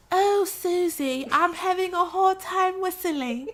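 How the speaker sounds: high, slow cartoon British piglet; horrible British accent. Peppa phoning Susie Sheep for help, bad high British accent. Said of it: "I know my British accent is horrible".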